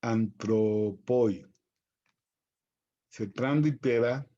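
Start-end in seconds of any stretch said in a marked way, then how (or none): 0.0-1.5 very slow "antropoi", opening on a plain a; unaspirated breathing. Shown as a demonstration. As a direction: spell out "antropoi" syllable by syllable, no h. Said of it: "se, se diría antropoi". ("antropoi" muy despacio, sin aspiración)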